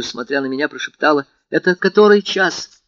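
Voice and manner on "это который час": aching whisper. A narrator's reading. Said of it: "с невыразимой болезненной тоскою ... прошептала".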